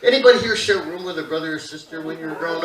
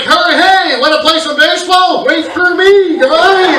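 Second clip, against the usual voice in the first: loud, high whiny loser voice; loser friend; 11 semitones higher, 8 dB louder than usual. Retold or imitated. Dad's high school friend loudly begging to join baseball. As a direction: whine after the others, left behind. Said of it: "it must have been like".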